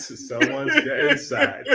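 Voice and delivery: slow, giggling repeat. Shown as a demonstration. Repeating the joke about the call, laughing slowly. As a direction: savour it with a laugh.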